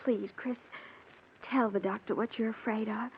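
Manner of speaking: tender quiet pleading; soft pleading. Acted. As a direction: coax him gently, almost hushed. Pleading softly with fiancé.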